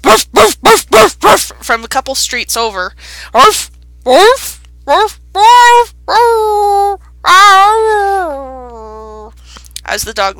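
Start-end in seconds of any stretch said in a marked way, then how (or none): 0.0-1.5 high, loud barking; dog barking. Acted. The dog barks from a couple streets over. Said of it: "you hear just this". 3.3-9.3 high loud barks, dying away; bark, then let it fade as the dog loses track; dog barking fading. Acted. The dog loses track of the cat, barking and fading.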